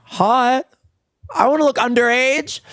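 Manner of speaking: high, silly vain voice; mock vain. Doing skincare, vain high-pitched joke. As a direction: play it up, vain and giddy.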